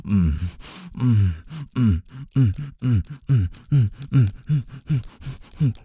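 rising, pleasured moans; moaning. Acted. Intimate moaning in sex scene.

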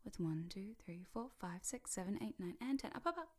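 wake-up voice. Shown as a demonstration. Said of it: "I always use that voice".

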